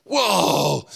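high, impressed whoa; amazed. Acted. Acts out amazed job candidate.

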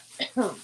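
one quick dry cough; short cough. Real, not acted. Host coughing during a hot flash. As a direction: clear the throat with a cough. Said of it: "Whew, I'm getting hot".

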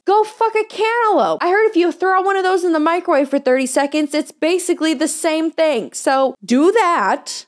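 high, jeering sneer of fake advice; mocking advice. Host jeers at abusers with high sarcastic advice.

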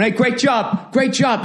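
high mocking jeer of a dad-bod fan; mock heckling fans. Imitating fans jeering Celtics off the court, high and mocking. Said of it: "yelling at the Celtics as they're going off the court".

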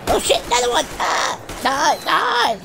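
high yelled panic; alarmed shout. Real, not acted. Player fighting off a second creature, alarmed shout. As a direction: yell at the new attacker.

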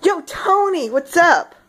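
hammed-up American accent; full American. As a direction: call out with brash American swagger. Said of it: "Full freedom powers".

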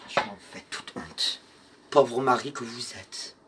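plus grave et plus doux, presque murmuré; voix douce de médium. Acted. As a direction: apaiser Pierrot comme en transe. Voix douce parlant à Pierrot. (elle rassure Pierrot à voix basse et grave)

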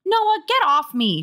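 high, forceful, drawn-out protest; demanding. A narrator's reading. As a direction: order a pesky brother off. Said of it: "she demanded".

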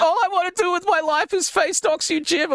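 high whiny mock of an emotional rant; mocking whiny Josh. Mocking Josh's high emotional rant at Jim. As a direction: whine it high like a hurt villain.

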